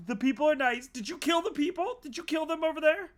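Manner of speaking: high, loud and frantic; frightened crew member pleading with the alien; panicked pleading. Acted. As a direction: beg him for an answer, voice cracking high. Panicked character questioning captor.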